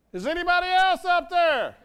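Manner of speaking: high, loud comic holler upward; yelling for help. Acted. Clinging man calling for a second opinion, yelling upward. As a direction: call out at full voice for someone else. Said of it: "he keeps yelling that".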